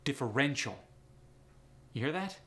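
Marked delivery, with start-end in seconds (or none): 0.0-0.8 "Differential" stressed on the REN syllable. Shown as a demonstration. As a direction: hit the third syllable of "Differential". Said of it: "The emphasis is on the N".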